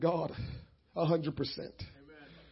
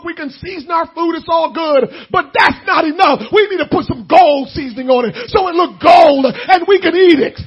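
preaching at full force; 8 semitones higher, 15 dB louder than usual. Real, not acted. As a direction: shout it to the rafters.

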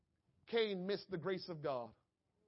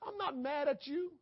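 higher and rising "I'm not mad at you"; urgent pleading; 6 semitones higher than usual. Acted. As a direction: lift it up in earnest. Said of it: "That's all God is saying".

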